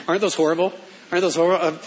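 ordinary talk

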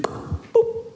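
tiny high-pitched pop noise; vanishing boop. Retold or imitated. Preacher showing how the ghost just disappeared, comic boop. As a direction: imitate someone blinking out with a little boop.

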